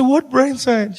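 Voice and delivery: high, tearful mock wail; mock crying. Crying son questioning his mother, mock wailing. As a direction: blubber it in exaggerated disbelief. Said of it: "So I'm crying for everything".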